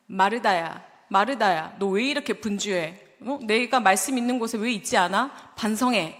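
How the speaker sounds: loud, harsh rebuke of a busy sister; stern scolding. Retold or imitated. Pastor imitating a Jesus who scolds Martha, loud and harsh. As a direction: scold her sharply for being so busy. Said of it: "이렇게 말씀하셨을까요?".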